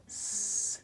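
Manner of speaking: "س" as a long voiceless hiss; s sound. Shown as a demonstration. Teacher contrasting plural endings, "س" as a drawn-out hiss. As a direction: hold "س" as a pure "sss".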